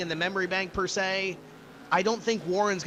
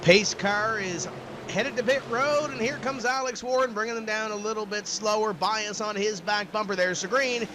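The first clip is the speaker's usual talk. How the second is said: raised-pitch restart call; live play-by-play; 4 semitones higher than usual. Real, not acted. Announcer calling the restart to viewers, live play-by-play. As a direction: call the green flag as it drops, voice lifted.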